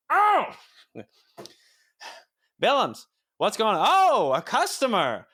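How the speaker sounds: high, loud flustered associate; excited high voice. Acted.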